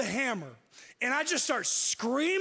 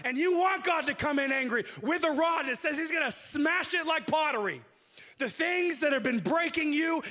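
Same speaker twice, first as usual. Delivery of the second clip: fiery preaching; 6 semitones higher than usual. Acted. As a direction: preach it with rising conviction. Preaching at full force.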